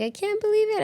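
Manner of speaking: high, choked-up imitation of an emotional runner; mock tearful disbelief, voice jumping way up; teary TikTok runner. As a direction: squeak it out like you're about to cry. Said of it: "and they're like".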